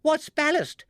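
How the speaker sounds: high, loud squeal. A narrator's reading. Baby Ted asking in the falling balloon, high squeal. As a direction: squeal the question in a panic. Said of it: "squealed Baby Ted".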